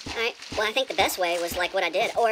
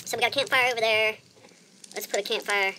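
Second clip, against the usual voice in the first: normal talking voice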